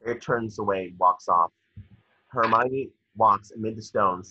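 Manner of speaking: ordinary talk, nothing marked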